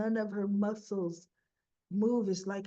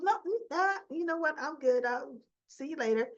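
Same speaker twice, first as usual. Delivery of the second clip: high, flustered excuse; awkward brush-off; 5 semitones higher than usual. Retold or imitated. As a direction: squeak out an awkward exit. Christina awkwardly escaping the family.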